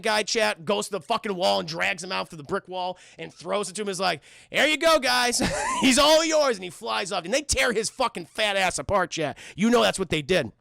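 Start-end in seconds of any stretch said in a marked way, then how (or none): 4.5-6.6 higher, louder gleeful call; mock shout. Iron Man tosses the lieutenant to villagers, laughing shout.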